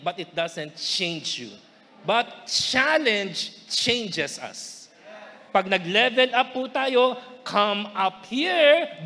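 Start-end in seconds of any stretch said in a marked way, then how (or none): 2.0-4.9 slow emphatic point. Acted. Preacher lands key point. 7.5-9.0 slow invitation. Acted. Preacher repeats key phrase.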